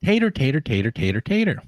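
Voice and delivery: high Southern tater, tater, same pace. Retold or imitated. The man repeating tater for the wife, in the same Southern accent. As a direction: stubbornly repeat tater in a thick Southern drawl. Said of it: "say it a bit slower and repeat".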